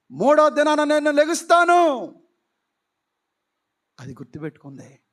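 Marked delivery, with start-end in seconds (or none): 0.1-2.1 loud, higher proclaiming voice of Jesus; raised promise. Acted. Preacher voicing Jesus, loudly proclaiming he will rise. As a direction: announce it boldly to the listeners.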